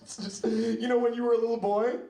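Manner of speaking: mock-crying mother on the phone; crying mom impression. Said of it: "She was crying. She's like".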